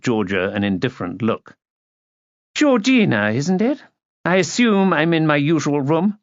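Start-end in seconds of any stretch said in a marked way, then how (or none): 2.5-6.2 high, condescending society lady; imperious lady. A narrator's reading. Lady arriving as guest to young hostess, haughty. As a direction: talk down to her grandly.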